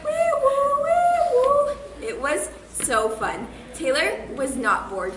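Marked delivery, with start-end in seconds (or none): 0.0-1.8 very high wee woo siren noise; high-pitched mock alarm wail; siren imitation. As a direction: sing out the alarm like a cartoon siren. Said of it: "this alarm sounds like".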